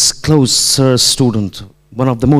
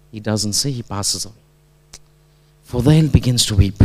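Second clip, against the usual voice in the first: ordinary delivery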